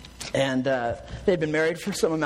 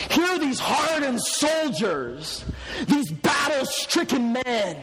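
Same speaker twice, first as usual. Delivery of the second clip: raised, higher pulpit voice; forceful preaching; 5 semitones higher than usual. Real, not acted. Preacher describes battle-hardened soldiers to congregation, forceful and raised. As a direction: build each phrase with emphatic repetition.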